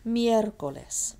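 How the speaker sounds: slow "Miércoles" with a high, strong first syllable; stress on MIER. Shown as a demonstration. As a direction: stretch and punch "Miér" in "Miércoles".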